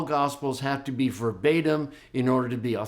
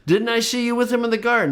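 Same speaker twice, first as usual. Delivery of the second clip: high, fast, pointed question; challenging servant; 9 semitones higher, much faster than usual. Pretend. High priest's servant challenging Peter, high fast accusing question. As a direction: catch him out.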